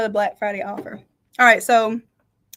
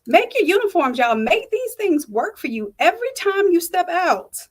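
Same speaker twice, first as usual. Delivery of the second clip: motivational; 6 semitones higher, 4 dB louder than usual. Real, not acted. Pep talk urging viewers.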